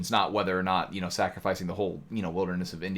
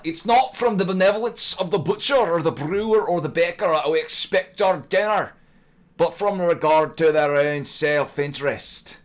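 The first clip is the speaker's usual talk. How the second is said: high, loud Scottish brogue, slowing at the end; Scottish accent; 8 semitones higher, 8 dB louder than usual. Shown as a demonstration. Quoting Adam Smith as a Scotsman would, Scottish accent. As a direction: read the quote in a booming Scottish accent. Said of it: "probably would have sounded more like".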